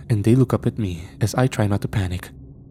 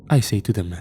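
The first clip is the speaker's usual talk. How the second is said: plain reading voice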